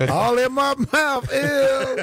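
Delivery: high, drawn-out gross-out whine; hammed-up squeamish wail; mock disgust.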